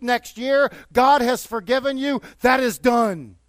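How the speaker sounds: loud, high, fervent preaching; full-force preaching. Acted. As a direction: preach it out with rising fire.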